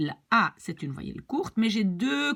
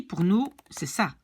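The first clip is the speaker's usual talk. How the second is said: normal talking voice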